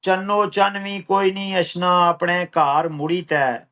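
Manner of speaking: rhythmic, measured verse with a returning refrain; poetry recital. Acted. Poet reciting a poem on migration, measured rhythmic recital. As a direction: recite it unhurried, falling on each refrain.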